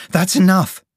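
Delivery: high, loud cry of protest; fed-up cry. A narrator's reading. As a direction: cry out that you have had it. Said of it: "she cried".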